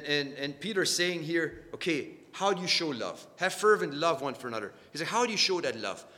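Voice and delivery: ordinary delivery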